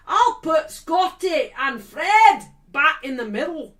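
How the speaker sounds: loud, high, slow Scottish bellow; Scottish manager's hammed-up threat; Scottish accent. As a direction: thunder it in a put-on Scottish accent. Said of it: "I'll put on my Scottish accent".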